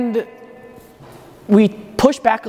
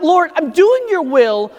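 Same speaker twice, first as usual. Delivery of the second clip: angry, frustrated prayer; 6 semitones higher than usual. Retold or imitated. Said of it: "again angry and frustrated".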